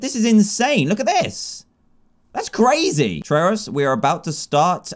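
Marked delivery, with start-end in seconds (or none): 0.0-3.2 excited. Real, not acted. Amazed at game event.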